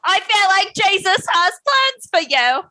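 very high mock accent; hammed-up impression with a botched accent; [name]. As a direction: squeeze it up high, badly accented. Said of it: "Was that how she sounded?".

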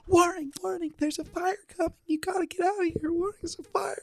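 very high, soft, fading to a whisper; timid Fluttershy warning. Retold or imitated. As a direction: warn everyone but barely audible. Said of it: "at the top of her lungs".

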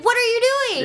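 very high, incredulous demand; retold protest with the pitch leaping up; exasperated challenge. Confronting the man grabbing her stuff, exasperated challenge.

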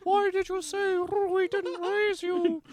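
very high, slow cartoon mother voice; mom voice. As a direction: squeak it out like a hurt mother. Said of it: "Shut up, Mom".

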